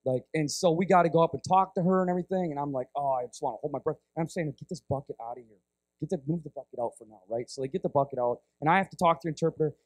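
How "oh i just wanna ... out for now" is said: low, hushed urgent aside; quiet, low-pitched retold plea; holding back disgust. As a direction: retell it low and soft, as if avoiding the smell. Retells holding breath at stench.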